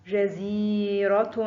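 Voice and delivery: جزيرة slowly, زي held long; drawn-out example word. Shown as a demonstration. Teacher showing pupils the long vowel, slow and stretched.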